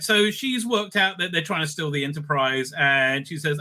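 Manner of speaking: normal talking voice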